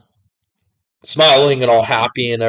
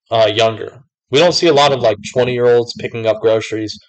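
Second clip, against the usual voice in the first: usual speaking voice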